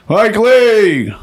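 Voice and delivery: loud, high call of a name over a stadium PA; imitating the stadium speakers calling his name, loud; stadium announcer call. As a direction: boom it out like an announcer.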